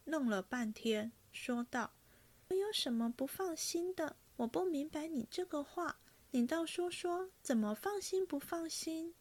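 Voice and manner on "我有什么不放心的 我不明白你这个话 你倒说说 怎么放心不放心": a young woman pretending not to understand; 愣怔地追问; puzzled girl. A narrator's reading.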